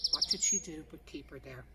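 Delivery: usual speaking voice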